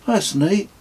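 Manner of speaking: put-on high, pleased boyish voice; a kid who likes the answer; impressed boy. Kez reacts to answer.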